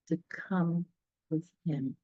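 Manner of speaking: ordinary delivery, nothing marked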